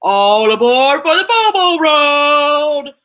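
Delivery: a loud booming All aboard; booming call. Acted. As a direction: call out like a bus driver. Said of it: "Big Ali booms".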